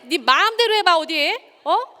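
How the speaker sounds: loud, high menacing challenge; menacing dare. Shown as a demonstration. Lecturer showing how the same words become a loud menacing dare. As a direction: dare them to try it, full of threat. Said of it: "굉장히 힘이 다른 말이죠".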